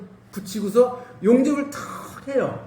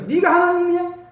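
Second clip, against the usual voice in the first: God's rebuke; 8 semitones higher, 4 dB louder than usual. Retold or imitated. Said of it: "하나님의 책망이 그거예요".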